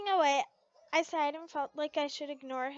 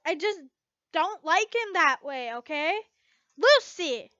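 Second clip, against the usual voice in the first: loud protest; 3 semitones higher, 8 dB louder than usual. Acted. Lucy snaps at Levy.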